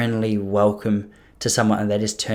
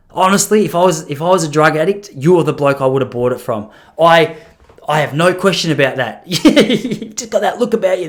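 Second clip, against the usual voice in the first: eager flattery; 5 semitones higher, 7 dB louder than usual. Retold or imitated. Flattering the drug dealer.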